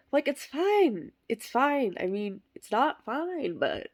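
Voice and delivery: high, slow, strained fine, fading to a whisper; forced calm with tight high pitch; awkward reassurance. Acted. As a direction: pretend it's fine and fail.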